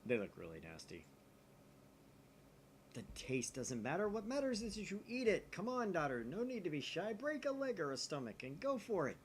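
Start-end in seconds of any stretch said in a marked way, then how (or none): 2.9-9.2 a hint of a grand, higher Okabe pushing Daru; lightly voiced mad scientist. Player voicing Okabe urging Daru to eat the blob, lightly hammed. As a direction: coax him with mock grandeur. Reads Okabe's dialogue aloud.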